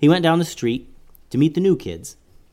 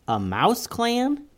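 slow high questioning rise; puzzled question; 11 semitones higher, much slower than usual. Acted. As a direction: ask it slowly, puzzled. Girl hears odd news.

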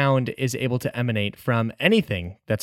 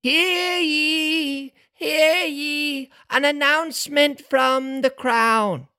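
town crier; 12 semitones higher, much slower than usual. Acted. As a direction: announce it like a royal herald.

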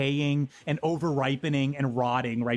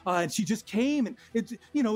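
ordinary delivery

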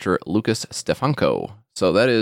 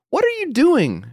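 exasperated yell. Retold or imitated. Scolding the smart speaker that woke sleepers, high yell. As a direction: snap at the rude speaker.

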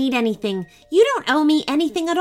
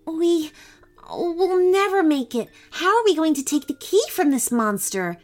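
high-pitched, mildly fearful; narrated character losing courage, pitched up; trembling voice; 8 semitones higher than usual. A narrator's reading. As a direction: voice a daunted girl, higher and quavering a little. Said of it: "said Christine in a trembling voice".

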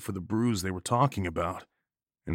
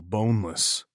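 usual speaking voice

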